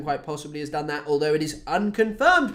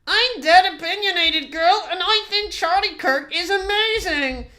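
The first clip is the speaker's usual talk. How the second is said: high, laughing mock girl voice; opinionated girl; 12 semitones higher, 4 dB louder than usual. Mocking a TikTok critic, high laughing girl voice. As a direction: play the smug opinionated girl, pitched high. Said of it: "that opinionated girl who talks like this".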